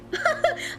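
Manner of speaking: sudden high squealing laugh; bursting out laughing; high burst of laughter. Real, not acted. Laughing at guess.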